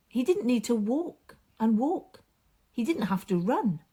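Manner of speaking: plain reading voice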